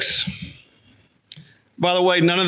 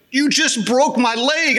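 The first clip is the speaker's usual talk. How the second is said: higher, slow wounded accusation; overdramatic accusation; 6 semitones higher than usual. Retold or imitated. Man accusing a five-year-old of breaking his leg, melodramatic. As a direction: accuse the child as if truly injured. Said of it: "I'm talking this way to a five-year-old kid".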